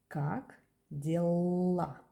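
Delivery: Как дела said slowly and clearly, stress on дела; slow model phrase. Shown as a demonstration. Teacher modelling Как дела slowly. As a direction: spell out Как дела for a learner. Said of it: "repeats the phrase slowly for learners".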